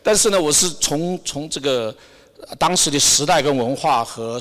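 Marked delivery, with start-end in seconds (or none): none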